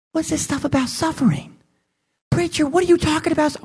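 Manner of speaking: mock doubting listener.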